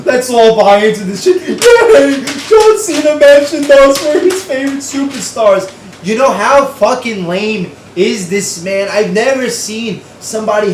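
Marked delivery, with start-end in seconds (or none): 0.1-5.7 high, loud fake cheering through laughter; sarcastic mock cheer. Ranting vlogger mocking a wrestler's promo with fake cheers. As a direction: cheer it like a mocking fan, then sneer.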